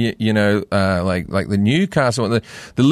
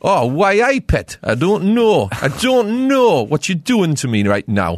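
higher-pitched, swooping Geordie; Newcastle accent; 6 semitones higher, slower than usual. Shown as a demonstration. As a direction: do a broad, exaggerated Geordie. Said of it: "like, Newcastle is".

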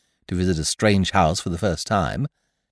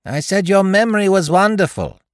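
much higher and louder, for a deaf ear; raised voice; 10 semitones higher, 5 dB louder than usual. A narrator's reading. Guest loudly repeating his compliment to the deaf aunt. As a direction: speak up so she hears. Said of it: "Bertie repeating to deaf aunt".

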